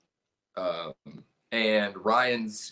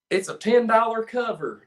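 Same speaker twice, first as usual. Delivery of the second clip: put-on door lady's Southern accent; 8 semitones higher, 5 dB louder than usual. Retelling the door lady's Southern accent for the cover charge. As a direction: repeat the bar lady's Southern accent, a bit off. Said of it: "I do the accent kind of wrong".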